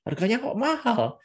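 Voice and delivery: high, astonished exclamation about the price; demonstrated price shock; showing surprise. Shown as a demonstration. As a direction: act taken aback, pitch jumping up. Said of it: "Ini adalah menunjukkan keterkejutan".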